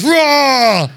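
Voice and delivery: loud, forceful roar; full-voiced defiant scream. Shown as a demonstration. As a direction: roar at what troubles you. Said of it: "And scream at them".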